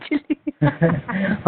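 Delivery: short high laugh. Real, not acted. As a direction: break into a quick laugh. Couple teasing on the phone. (छोटी सी ऊँची हँसी)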